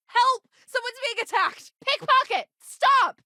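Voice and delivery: very high, frantic cries; alarmed calling out. Acted. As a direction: raise the alarm down the street. Bystander raises the alarm.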